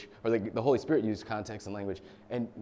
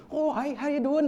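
strong Irish accent imitation, pitched way up; Connor; 14 semitones higher, 4 dB louder than usual. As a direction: tease a friend with a high, exaggerated Irish accent. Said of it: "like, intensely strong accent".

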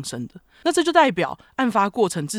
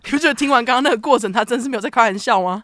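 quick, raised emphatic question; 6 semitones higher, 5 dB louder, faster than usual. Real, not acted. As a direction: push the point hard and fast. Emphatic rhetorical question to co-host.

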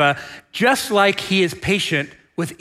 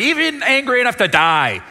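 higher, pushed indignant comeback; retorting; 6 semitones higher than usual. Acted. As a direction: insist on it like a pouting child. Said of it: "Jonah retorted".

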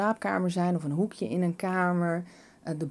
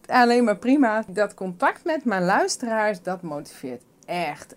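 normal talking voice

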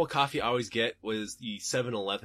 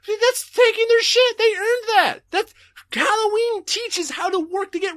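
much higher, louder, slow indignant cry; mock outraged protest; 8 dB louder than usual. Kid defending Halloween candy, loud high mock outrage. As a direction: whine it like a wronged kid defending their candy. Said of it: "It's like".

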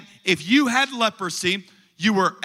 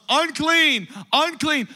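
mock yell; 9 semitones higher, slower than usual. Leper warning a big crowd he is coming, imitated yell. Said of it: "yell at the top of your lungs".

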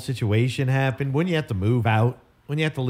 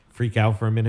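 usual speaking voice

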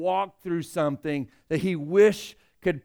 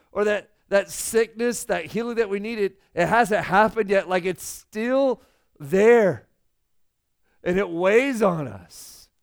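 impassioned preaching; 4 semitones higher, 4 dB louder than usual. Acted. Pastor builds an impassioned appeal.

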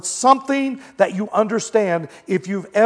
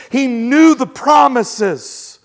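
normal talking voice

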